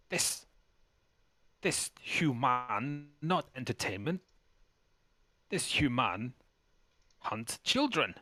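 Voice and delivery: slow, halting goblin queen in broken speech. Acted. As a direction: pronounce judgement word by word, drawn out. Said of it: "and she says".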